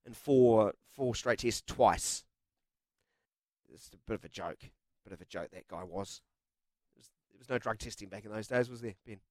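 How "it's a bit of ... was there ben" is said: dropped low and soft, as if sharing a naughty secret; mock conspiratorial aside. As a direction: lean in and murmur the dig, half joking. Mock cheeky dig at Australians.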